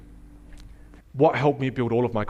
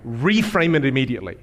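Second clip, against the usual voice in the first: usual speaking voice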